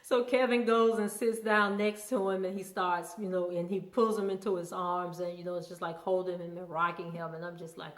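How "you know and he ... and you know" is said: ordinary talk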